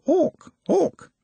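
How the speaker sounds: very high, thin mock scream; distant scream. A narrator's reading. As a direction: squeal it as a far-off alarm. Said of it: "there was a scream of".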